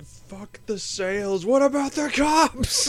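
high, slow, unhinged doctor voice; wild-eyed doctor screaming it out; crazed doctor. Retold or imitated. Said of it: "the doctor who's just like".

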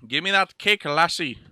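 put-on Irish brogue; mock Irish accent. Man demands cake from lassie in mock Irish. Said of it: "You know they say lassie".

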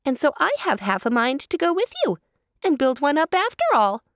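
high, lively hare voice; chatty hare. A narrator's reading. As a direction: chatter on like an eager little hare.

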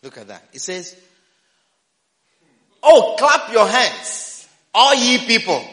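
usual speaking voice

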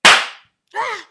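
loud, high pained yelp; sudden loud cry of pain. Acted. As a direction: cry out from a slap.

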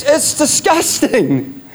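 ordinary talk, nothing marked

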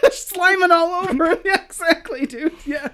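high, laughing through the words; can barely talk for laughing. Real, not acted. Laughing at a joke.

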